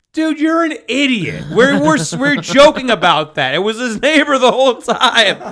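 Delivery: high, exasperated scoff at a gullible friend; mocking [name]. [name] telling Rob he fell for the joke, exasperated and high.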